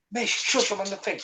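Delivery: hushing the dog off-mic; quick aside away from the phone; turning away from phone. Real, not acted. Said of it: "Oh, sorry, that was my dog".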